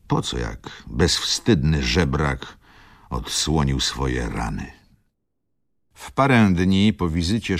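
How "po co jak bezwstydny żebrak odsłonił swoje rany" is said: ordinary delivery, nothing marked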